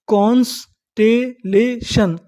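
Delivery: Constellation stretched slowly as कॉन्स-टे-ले-शन; syllable by syllable. Shown as a demonstration. Teacher assembling the word for learners, slow syllable by syllable. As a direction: draw out and separate each part of constellation. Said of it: "तो इतनी meaning".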